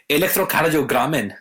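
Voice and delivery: playful fake-German pronunciation; mock German accent. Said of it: "The German name of E C G".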